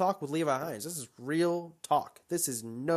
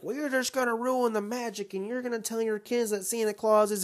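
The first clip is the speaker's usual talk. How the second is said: high, whiny voice of objecting parents, higher than his own; sending up the parents with a high sarcastic whine; mock objecting parents; 8 semitones higher than usual. Mocking parents who say he'll ruin the magic, in a high whiny voice. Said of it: "these parents are like".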